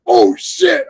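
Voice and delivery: high, startled oh shit; jolted by the scene on screen; a sudden high mock gasp of shock; shocked exclamation. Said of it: "And I was like".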